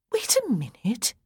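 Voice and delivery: breathy whispered interruption. A narrator's reading. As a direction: cut in under the breath.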